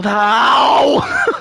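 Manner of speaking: high, drawn-out "ow" wail; mock wail of dread. Storyteller as the angry ex reaches the window, mock wail of dread. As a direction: wail in comic panic.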